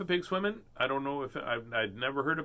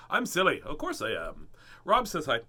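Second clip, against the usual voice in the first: normal talking voice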